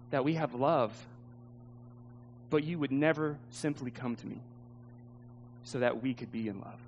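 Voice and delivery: low, hushed and halting; sorrowful reproach. Acted. Woman's sad reproach ending the romance.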